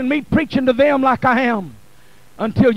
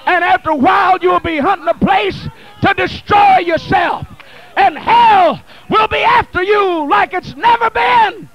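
building from steady to a loud, higher cry on blood; preaching at full force; 6 semitones higher, 5 dB louder than usual. Acted. Preacher urging the congregation, rising to full-force preaching. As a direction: whip the church up, climbing each line.

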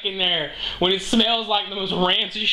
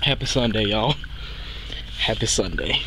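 mock deep greeting; 11 semitones lower than usual. Greeting viewers, mock solemn.

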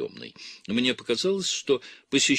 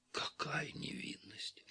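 breathless whisper, slowed right down; a slow, awed whisper to herself; hushed whisper; 4 semitones higher, 16 dB quieter, slower than usual. Acted. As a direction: breathe it out as a private marvel. Abbess murmurs, moved by innocence.

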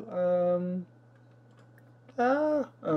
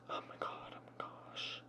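breathless whispered oh my god; whispered dismay; 20 dB quieter than usual. Real, not acted. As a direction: whisper it in horror. Dismay at dog mess.

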